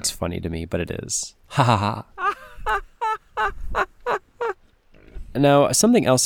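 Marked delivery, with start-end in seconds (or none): 1.5-3.8 laugh. Real, not acted. Laughing at own joke.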